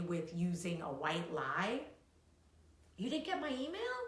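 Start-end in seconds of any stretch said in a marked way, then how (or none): 3.0-4.0 high, feigned innocent question; mock surprise. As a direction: act surprised they never got it.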